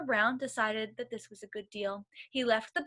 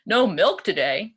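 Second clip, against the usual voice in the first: loud dismayed cry, lower; a farmer shocked by bad news; 3 semitones lower, 6 dB louder than usual. A narrator's reading. Farmer Brown reading the cows' note, loud dismay. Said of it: "cried Farmer Brown".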